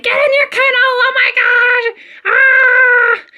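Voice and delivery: high-pitched panicked shriek, acted out; retold scream. Dog owner screaming her dog into the kennel, high mock scream. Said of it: "and I'm screaming".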